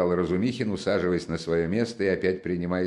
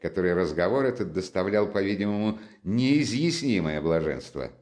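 plain reading voice

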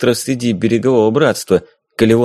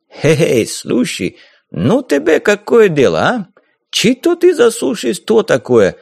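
put-on hot-tempered corsair in a thick Caucasian accent, higher; mocking Caucasian-accented pirate, raised pitch; 8 semitones higher than usual. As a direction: brush her off with a swaggering Caucasian lilt. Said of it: "возражал и горбоносый корсар".

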